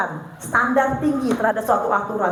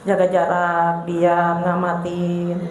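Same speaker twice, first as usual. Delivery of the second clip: low, slow and withdrawn; aloof, reserved; 8 semitones lower, much slower than usual. Shown as a demonstration. Speaker showing a thinking child holding back, low and slow. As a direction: pull back and watch coolly. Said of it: "respon awal kita seperti orang thinking".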